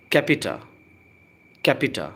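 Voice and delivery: "capita" said as "KEP-ih-tah"; model pronunciation. Shown as a demonstration. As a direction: repeat "capita", stressing the first syllable. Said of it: "pronunciation पर ध्यान दें". ("capita" को "केपिटा" बोलें)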